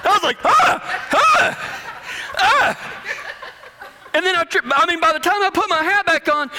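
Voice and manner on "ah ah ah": short high-pitched comic shrieks; panicked yelps. Retold or imitated. Cowboy yelps as gnats land on his head, high and panicked. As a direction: yelp in mock horror.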